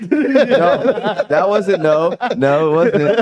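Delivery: high, laughing protest; laughing denial. Real, not acted. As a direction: deny it through a laugh. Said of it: "laughing denial".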